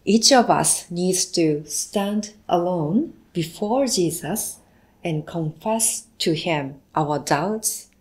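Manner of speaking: ordinary delivery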